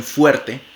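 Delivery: "fuerte" with a clear lip-teeth f; labiodental f in fuerte. Shown as a demonstration. Phonetics teacher modelling the lip-teeth f in fuerte. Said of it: "como en f, cuando ustedes dicen".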